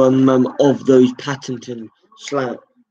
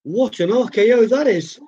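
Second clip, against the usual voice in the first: impressed exclaim; 12 semitones higher than usual. Real, not acted. Commentator marvels at the move.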